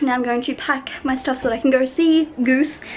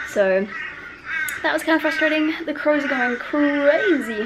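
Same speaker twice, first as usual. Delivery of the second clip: ordinary delivery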